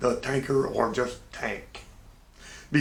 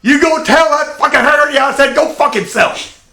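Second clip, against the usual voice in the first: much higher, much louder, a different harsh voice; furious mock yell; 12 semitones higher, 11 dB louder than usual. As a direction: explode with contempt for Haggerty. Tank explodes at Haggerty's order.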